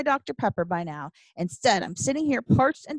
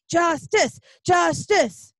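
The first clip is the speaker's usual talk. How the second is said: loud, high chant for justice; tantrum-like protest; 9 semitones higher, 7 dB louder, much slower than usual. Acted. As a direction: pound out each cry like a protester. Protest chant repeated at the waiter.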